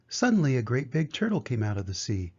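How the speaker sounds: ordinary delivery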